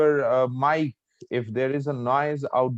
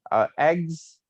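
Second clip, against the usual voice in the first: "eggs" pitched higher as a list item; list intonation; 5 semitones higher, 4 dB louder than usual. Shown as a demonstration. Teacher showing how the tone changes, cross tone. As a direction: lift "eggs" and leave it hanging. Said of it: "the tone goes like this".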